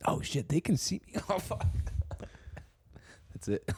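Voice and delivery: Sasquatch voice. Pretend. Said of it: "this is me if I was Sasquatch".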